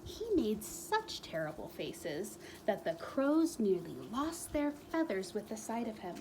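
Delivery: usual speaking voice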